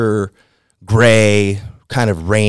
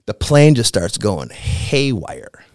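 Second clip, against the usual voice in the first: ordinary talk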